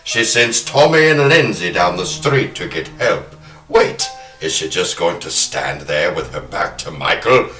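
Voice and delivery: normal talking voice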